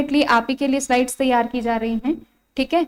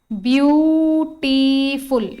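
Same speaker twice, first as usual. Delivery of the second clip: Beautiful stretched very slowly as ब्यू-टी-फुल; syllable by syllable; 2 semitones higher, 3 dB louder, much slower than usual. Shown as a demonstration. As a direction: draw out each vowel of beautiful, loud and slow. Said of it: "एक से ज्यादा vowel sound है". (Beautiful का हर स्वर खींचकर, धीरे-धीरे)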